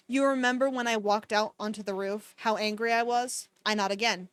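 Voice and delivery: calm, even read-aloud of a romance novel; story narration. A narrator's reading. Streamer reading the novel to chat, even storytelling. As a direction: read the book steadily to the chat.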